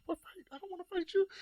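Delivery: high disbelieving what fight; incredulous protest. Retold or imitated. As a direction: sound shocked anyone thinks you'd fight. Denying wanting fight, incredulous.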